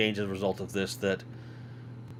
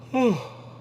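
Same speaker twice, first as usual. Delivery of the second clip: long high yawning sigh; 13 semitones higher than usual. Real, not acted. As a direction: let a big yawn out. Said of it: "I'm yawning".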